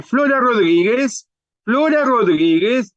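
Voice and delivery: high sing-out passenger call; como llamando. Acted. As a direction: keep calling the name with a rising end. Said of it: "Como llamando". (llamado agudo y sostenido)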